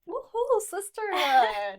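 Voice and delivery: loud, high whoop of joy; cheering. Real, not acted. Host cheering sisterhood.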